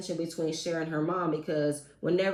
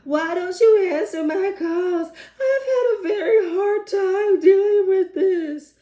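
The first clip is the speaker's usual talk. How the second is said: much higher, louder, slowed crying wail; mock yelling sob; 11 semitones higher, 9 dB louder, slower than usual. Sister yelling at Tierra on the phone, mock crying wail. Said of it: "she just yells on the phone".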